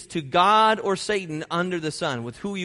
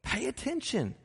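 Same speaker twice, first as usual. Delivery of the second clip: softer, a higher pitch, leaning in; soft, earnest charge; 4 semitones higher, 10 dB quieter than usual. Real, not acted. Preacher quietly warning the listeners to pay attention.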